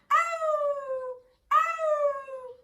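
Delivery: high loud yelps; howl of pain. Acted. Wolf howling after the door hit his nose. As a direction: yelp like a hurt dog. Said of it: "howled the wolf".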